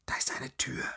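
urgent, voiceless whisper; frightened whisper. A narrator's reading. Frightened woman spots a hiding place, urgent whisper. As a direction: whisper it as if about to be caught. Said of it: "flüsterte Lazaralen".

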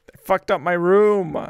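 loud outraged yell; angry shout. Real, not acted.

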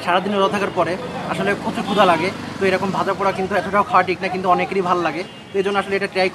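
speaking between bites; talking while chewing. Real, not acted. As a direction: mouth partly full of food. Seen 0: Bit chop at -5; mouth looks busy while talking.

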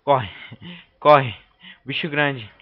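loud alarmed calls to run; being chased. Real, not acted. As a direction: shout it while fleeing. (fugindo assustado)

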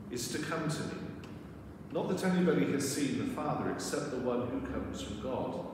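normal talking voice

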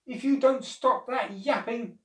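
high, loud angry threat; acted old man losing his temper; snapped. A narrator's reading. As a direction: snap crossly at a noisy dog. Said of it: "the old man snapped".

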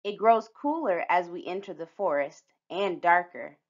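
ordinary delivery, nothing marked

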